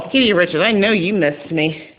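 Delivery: normal talking voice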